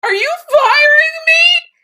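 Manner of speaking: disbelieving cry at the producer; shocked wail. Retold or imitated. Asking the producer if she is fired, high loud near-crying wail. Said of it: "And I go".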